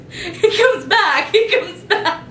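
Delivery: high, drawn-out mock reassurance; imitated friend reassuring. Friend reassuring her about Edward leaving, high mock-urgent reassurance. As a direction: squeal it slowly, laughing through it.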